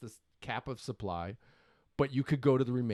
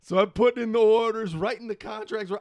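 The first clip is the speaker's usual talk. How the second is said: excited; 9 semitones higher, 11 dB louder than usual. Real, not acted. Laughing frenzy of buying.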